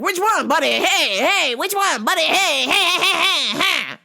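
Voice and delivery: high, loud nagging hey buddy; pushy customer. Retold or imitated. Customer badgering a busy worker, high and loud. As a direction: pester like an impatient customer. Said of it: "and he's like".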